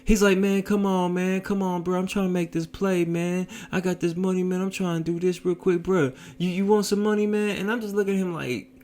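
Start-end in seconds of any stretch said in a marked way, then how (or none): 0.4-7.6 high-pitched imitation of tearful begging; crying plea. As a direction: mimic a desperate passenger whining for a favor. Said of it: "And he's crying".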